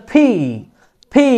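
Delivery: "ফি" with long steady i; vowel held one beat. Shown as a demonstration. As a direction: stretch "ফি" after a breathed P. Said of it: "এক আলিফ টানবেন".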